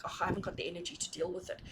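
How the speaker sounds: fast, soft, tired mumble; a quick, low-energy excuse muttered under the breath; weary brush-off. Retold or imitated. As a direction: toss it off like you can't be bothered.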